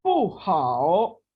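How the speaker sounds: पू हाओ stretched, हाओ dipping; careful drill reading; slow tone demo. Shown as a demonstration. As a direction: model पू हाओ syllable by syllable. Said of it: "next".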